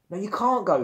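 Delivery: high, loud protest; shouting. A narrator's reading. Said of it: "he shouted".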